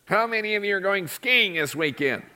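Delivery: lightly nasal imitation of a teacher; nasally teacher voice. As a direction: pinch it through the nose a little. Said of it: "He had a really nasally voice".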